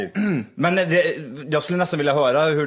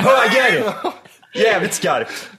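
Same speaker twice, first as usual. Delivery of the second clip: high, loud mock rally shout; hammed-up co-driver yell; 7 semitones higher, 4 dB louder, much slower than usual. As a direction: yell the turn over engine noise. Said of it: "Du måste skrika".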